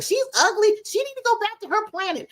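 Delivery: high, sneering schoolkid taunt; mocking bullies. Retelling how kids taunted her, high mocking sneer. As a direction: mimic the mean kids jeering at her. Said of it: "They're like".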